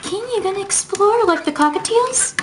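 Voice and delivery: high, sing-song voice for the birds. Real, not acted. Cooing to pet birds.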